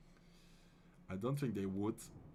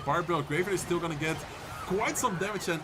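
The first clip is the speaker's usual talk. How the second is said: loud, higher, excited caster call of the final seconds; live play-by-play; 5 semitones higher, 5 dB louder than usual. Acted. Caster calling the last seconds of the match to stream viewers, loud and excited. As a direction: call the battle like a hyped esports caster.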